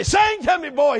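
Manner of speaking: put-on drunk father calling high; demanding drunk daddy; town drunk. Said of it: "And he'd say".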